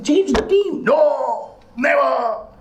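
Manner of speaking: very high, strained mock yell; hammed-up high-pitched protest; exasperated shout. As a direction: act out shrieking in exasperation. Acts out exasperated plea to son.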